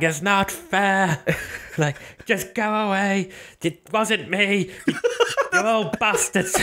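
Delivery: put-on high, drawn-out childish wail; mock whining villain. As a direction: imitate a cornered man's petulant wailing. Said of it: "'Cause he sounded like".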